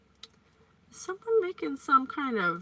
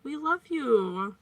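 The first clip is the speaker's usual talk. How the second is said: normal talking voice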